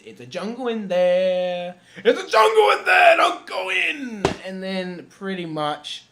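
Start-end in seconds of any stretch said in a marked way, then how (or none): 0.1-1.8 loud, drawn-out quoted line; quoting the movie. Retold or imitated. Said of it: "According to the thing where it says". 2.0-4.3 very high, very loud mock shout; shouted movie quote. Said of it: "According to the thing where it says".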